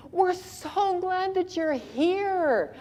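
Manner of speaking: high, slow, syrupy welcome; slow high over-sweet greeting; gushing welcome. Retold or imitated. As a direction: lay the welcome on thick. Dad welcoming courier.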